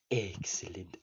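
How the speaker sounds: low, soft sinister "Excellent"; Mister Burns. Retold or imitated. Quoting the boss's catchphrase, low and soft. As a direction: purr it like a scheming old tycoon. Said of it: "Mister Burns goes".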